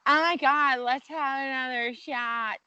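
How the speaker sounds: high-pitched party-girl squeal, mocking; novice drinker. Seasoned drinker mocks novices ordering shots, novice drinker impression. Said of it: "novice drinkers are like".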